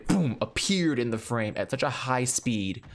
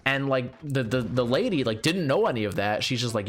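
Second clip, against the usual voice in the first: ordinary delivery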